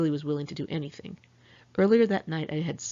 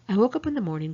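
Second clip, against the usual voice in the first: ordinary delivery, nothing marked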